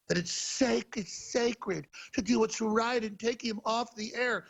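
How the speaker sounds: high, slow mock-righteous proclamation; mimicked sanctimony. Host quoting Tim Cook on censoring him, mock-sanctimonious. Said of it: "He actually says".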